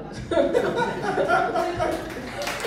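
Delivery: high, joyful laugh; happy laugh. Real, not acted. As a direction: laugh with relief and joy. Joy after pastor's invitation.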